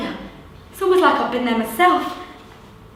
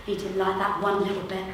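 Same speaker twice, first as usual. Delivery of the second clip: ordinary delivery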